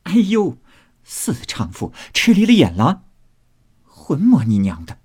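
mock-outraged yelp and scolding; laughing cursing. A narrator's reading. Mistress smeared with crab cursing the maid, mock outraged. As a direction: curse in play, leaping high. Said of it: "凤姐也禁不住笑骂道".

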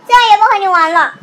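loud, fast furious yell; shouting. Acted. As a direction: storm off with a yell. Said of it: "小熊妹妹大声喊着". (大声喊叫)

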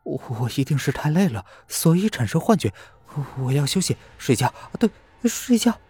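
high, shaky stammering self-reassurance; frightened. A narrator's reading. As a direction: talk yourself down, still scared. Lin Wei frightened, calming herself.

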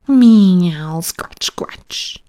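a loud mew, then scratch, scratch in a breath; cat meowing and scratching. A narrator's reading. Simpkin scuffling at the windowsill, loud mew then breathy scratching. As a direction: mew loudly, then scrape the words out breathlessly. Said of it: "scuffled Simpkin".

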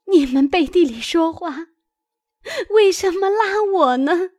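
high crying voice, climbing; tearful protest. A narrator's reading. Frightened maid protesting to the husband, crying. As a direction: wail in grievance, pitched far up. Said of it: "哭道".

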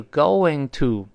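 going to said slowly in two clear words; careful, unreduced "going to"; full form. Shown as a demonstration. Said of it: "going to读快就是gonna".

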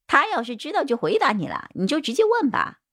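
high-pitched character voice from the sidelines; bald suspect chiming in. Acted. (光头嫌犯尖着嗓子插话)

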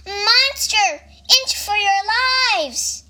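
storybook warning cried out high; alarmed cry. A narrator's reading. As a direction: raise the alarm as a little storybook creature. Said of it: "So he cried".

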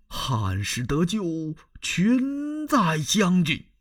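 put-on high, slow, pleading old minister; kneeling plea. Kneeling minister pleading with the general to save the Han, high and slow. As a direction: beg him from your knees, drawn out. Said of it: "当即撩衣跪倒".

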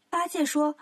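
ordinary delivery

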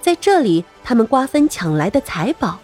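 plain reading voice

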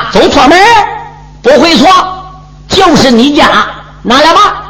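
lightly voiced cocky warrior; brash hero. The hero demands his weapon.

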